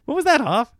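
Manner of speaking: hammed-up offended celebrity voice; mock-mad Adam Sandler. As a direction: play the star calling up miffed. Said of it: "he's gonna call and be mad".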